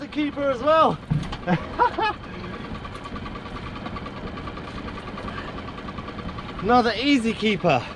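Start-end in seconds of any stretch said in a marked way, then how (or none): 1.0-6.6 airy giggle of delight; breathy excited laugh. Real, not acted. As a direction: laugh under your breath, thrilled. Laughing after the catch.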